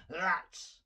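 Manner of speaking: a loud growled curse; angry growl. A narrator's reading. Dragon cursing his smashed clock, growled loud. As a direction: spit the word out in anger.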